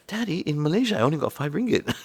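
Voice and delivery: put-on high, puzzled kid voice; Kea. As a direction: imitate a child querying dad, half laughing. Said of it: "Kea came in".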